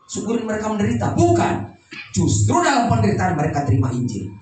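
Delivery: emphatic sermon voice with punched openings; preaching. Real, not acted. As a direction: hammer out each contrast to the congregation. Preaches at full force. (khotbah tegas penuh penekanan)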